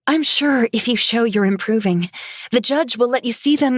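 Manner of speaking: high, careful reassurance; nervous placating. A narrator's reading. Placating an angry drunk.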